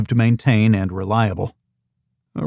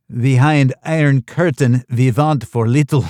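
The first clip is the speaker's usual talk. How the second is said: bad Russian accent; 3 semitones higher, 4 dB louder than usual. Host Yulia jokes with the guests in a bad Russian accent. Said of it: "She slipped into a bad Russian accent".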